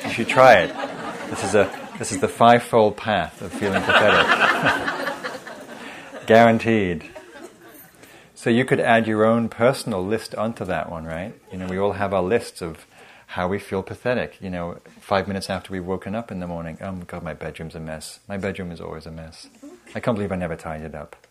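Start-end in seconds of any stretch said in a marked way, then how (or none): 4.3-6.2 a light airy burst of laughter; breathy laugh. Real, not acted. Teacher laughing at the pathetic checklist joke, airy laugh. 16.8-21.2 mock self-berating. Teacher voicing her own morning self-criticism, mock spiral.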